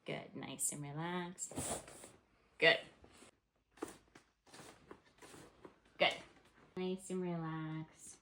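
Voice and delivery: hushed, soothing voice; calm the patient gently; soft reassuring. Real, not acted. Calming patient during adjustment.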